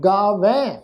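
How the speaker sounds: "ਗਾਵੇਂ" as gaa-vain, rising at the end; open "ai" ending. Shown as a demonstration. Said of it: "ਦੁਲਾਵਾਂ ਦੇ ਪਾਠ ਵਾਂਗ ਉਚਾਰਨ".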